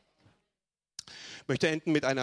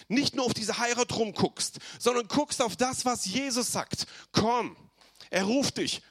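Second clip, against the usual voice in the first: full-force preaching; 7 semitones higher than usual. Acted. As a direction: call it out to the whole church. Preaching the call from heaven.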